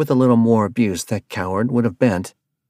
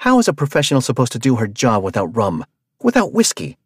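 loud, high-pitched angry tirade; yelling in his face, outraged; furious rant; 5 semitones higher, 5 dB louder than usual. Acted. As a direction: tear into him, furious and loud. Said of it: "launched herself off of the van".

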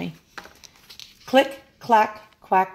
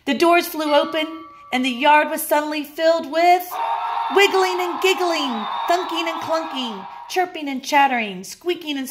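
higher, bubbling storyteller voice; excited narration; 6 semitones higher than usual. A narrator's reading. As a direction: burst open with the recess energy. Recess bursts open, lively noise.

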